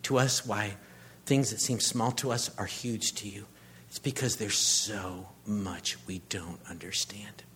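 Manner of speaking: hushed closing prayer. Real, not acted. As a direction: pray it softly, earnestly.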